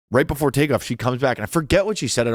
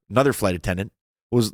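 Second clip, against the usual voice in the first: usual speaking voice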